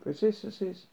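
understated guard's shout; mild bellow. A narrator's reading. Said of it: "Filled his lungs and bellowed".

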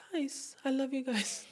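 real emotion welling up mid-sentence; getting emotional. Real, not acted. Telling listeners she loves them, choked up and soft. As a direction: voice catching, soft and tearful. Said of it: "I'm getting emotional".

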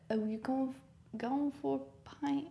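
put-on light pub-talk Irish accent. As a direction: ask it like an Irishman at the bar. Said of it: "I can't do an Irish accent, but I will try".

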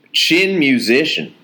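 slow, drawn out Texas accent; mild Texas accent reading a word. Shown as a demonstration. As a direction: say it like a Texan, slowly. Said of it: "I'll try and do the accent".

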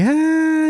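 long, high drawn-out "ah"; sustained high groan of protest; mock objection. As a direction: stretch out a high, exaggerated complaint. Voices objection to title shot.

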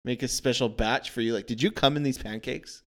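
normal talking voice

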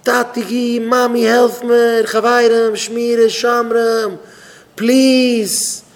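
high, loud panicked wailing; desperate cry for help. Acted. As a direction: scream for rescue, stretching the final plea. Dying man begs for help. (א פארצווייפלט געשריי נאך הילף)

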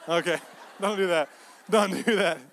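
ordinary talk

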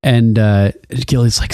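ordinary talk, nothing marked

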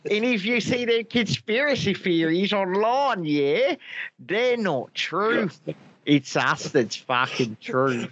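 toothy mock-Antipodean leader, slowing at the end; Jacinda Ardern. Prime minister warning about online theories, toothy mock accent. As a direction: teeth out, lecture in a kind-of-Australian accent. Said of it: "got my Jacinda Ardern down to a T".

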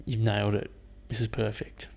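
silent fart voice. Retold or imitated. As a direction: murmur quiet praise as the silent fart. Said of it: "the guys are like".

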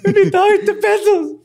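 high, loud squeal through laughter; playful complaint cracked up with laughter; laughing too hard to say it straight. Acted. Cracking up during cashier bit.

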